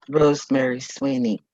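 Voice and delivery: usual speaking voice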